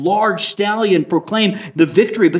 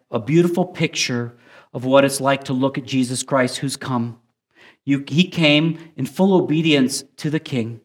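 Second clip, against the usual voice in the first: hushed, earnest closing prayer; 5 semitones lower than usual. Acted. Pastor leads closing prayer.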